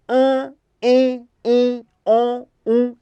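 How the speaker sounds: "an, em, in, on, um" as separate nasal vowels, held evenly. Shown as a demonstration. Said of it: "Dígrafo nasal". (vogais nasais "an, em, in, on, um", uma por uma)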